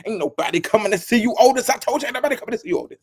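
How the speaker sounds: a light movie-line taunt, fast; quoting the Temptations movie line. Retold or imitated. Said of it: "who played Otis in The Temptations".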